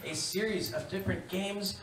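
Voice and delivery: loud, bright host patter; game show host. Acted. Host welcoming viewers, big announcer read.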